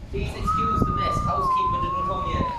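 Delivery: very high mock host voice; squeaky pretend housekeeper voice; high put-on voice. Joking host welcoming viewers.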